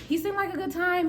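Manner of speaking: high but no longer shouted; toned-down justification. Acted. Storyteller justifying a fling to viewers, high-pitched without shouting. Same line repeated, toned down.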